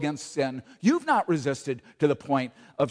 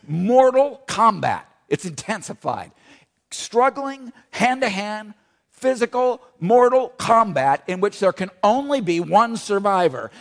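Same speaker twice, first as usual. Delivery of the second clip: louder, punched words about mortal combat; forceful preaching; 4 semitones higher, 6 dB louder than usual. Acted. The preacher urges the church about struggling with sin, forceful. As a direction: drive each phrase home to the congregation.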